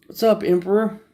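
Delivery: high, rising pet greeting; cooing cat greeting. Real, not acted. Owner greeting his cat Emperor, high cooing voice.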